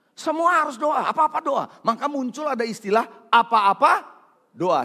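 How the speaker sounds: usual speaking voice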